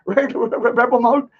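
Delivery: mocking imitation. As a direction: taunt him like a fussy nurse. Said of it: "I'm not here to freaking".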